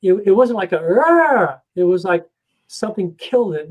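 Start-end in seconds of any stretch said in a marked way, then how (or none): 0.8-1.6 imitated scream. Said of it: "in the middle of his scream".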